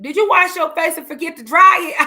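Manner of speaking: put-on loud, high-pitched kid's question; imitated baffled child. Child questions crying mom's wet face, mimicked loud bafflement.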